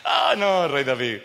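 mock laugh, then easing down; laughing reply. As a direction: laugh it off, pitch falling as you answer. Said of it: "Alguien se ríe y le dice". (respuesta entre risas, burlona)

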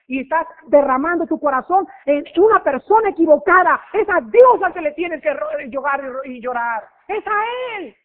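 impassioned sermon climax; fiery preaching. Real, not acted. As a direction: drive it home from the pulpit. Preacher rising to full force.

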